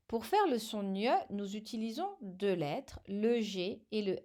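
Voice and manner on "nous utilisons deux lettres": ordinary delivery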